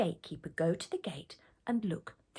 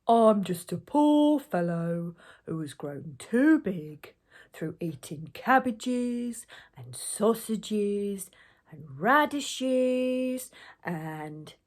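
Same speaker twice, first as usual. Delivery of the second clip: very slow, drawn-out ogre voice; 4 dB louder, much slower than usual. A narrator's reading. Slow ogre explains himself to gatekeeper, very drawn out. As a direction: drag every word out, pleading innocence.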